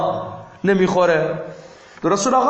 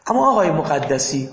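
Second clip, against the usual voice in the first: ordinary delivery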